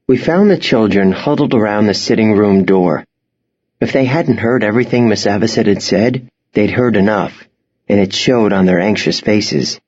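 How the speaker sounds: ordinary delivery, nothing marked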